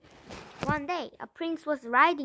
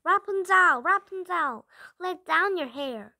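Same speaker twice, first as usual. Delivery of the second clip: loud, high call up the tower; 4 semitones higher, 7 dB louder than usual. A narrator's reading. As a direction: shout her name up, higher and louder. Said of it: "She called out".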